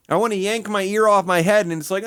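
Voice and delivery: usual speaking voice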